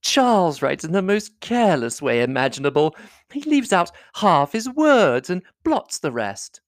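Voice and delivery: high, animated sisterly teasing; lively, high-pitched lady's protest; crying out. A narrator's reading. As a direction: tattle on a brother in a high voice. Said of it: "cried Miss Bingley".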